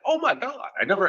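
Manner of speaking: high, mock-astonished gush; Shatner. Diner raving over another piece of fish, amazed. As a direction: marvel high-pitched at another bite. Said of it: "He's like".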